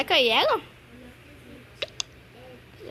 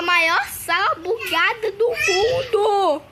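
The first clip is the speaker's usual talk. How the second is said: gritando e rindo; 5 semitones higher, 5 dB louder, slower than usual. Real, not acted. Sala bugada no jogo.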